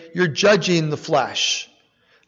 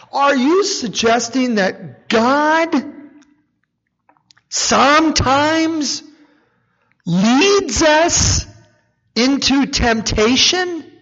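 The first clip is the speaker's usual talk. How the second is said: higher, startled protest, slowing on the question; incredulous objector; 7 semitones higher, slower than usual. Retold or imitated. Preacher voicing a listener protesting to Dave, high and incredulous. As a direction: play a shocked listener calling time out. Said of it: "So you're saying".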